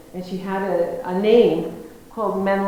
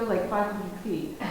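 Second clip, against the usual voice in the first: normal talking voice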